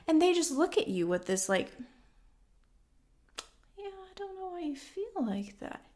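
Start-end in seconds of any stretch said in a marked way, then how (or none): 3.8-5.9 much higher, very soft, airy mock of a clueless listener; mocking dismissive person. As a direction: breathe it out like someone who just doesn't get it. Imitating dismissive emotionally immature person.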